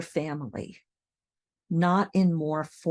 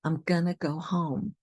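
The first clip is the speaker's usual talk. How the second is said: gonna and home stressed, I'm and go light. Shown as a demonstration. Teacher marks the sentence stress, gonna and home. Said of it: "The stress in this sentence is on gonna and home".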